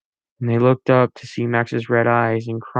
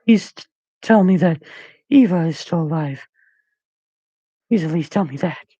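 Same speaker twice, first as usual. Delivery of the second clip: quivering voice; 6 semitones higher than usual. A narrator's reading. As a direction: beg with a shaking voice. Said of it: "With a quivering voice".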